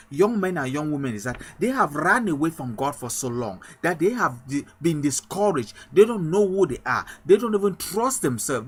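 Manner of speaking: ordinary talk, nothing marked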